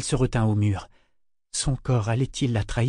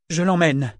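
raised, defiant announcement; 5 semitones higher, 4 dB louder than usual. A narrator's reading. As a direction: throw it down as a challenge. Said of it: "dit-il sur un ton de défi". (sur un ton de défi)

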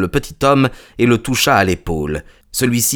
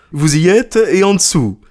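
triumphant challenge; 9 semitones higher, 4 dB louder than usual. Acted. Merchant presses his point home.

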